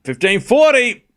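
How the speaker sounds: score call. Real, not acted. Calling the tennis score.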